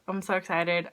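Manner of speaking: ordinary talk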